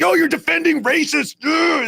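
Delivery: hammed-up high yell imitating the angry voter; mocking impression. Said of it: "He's like".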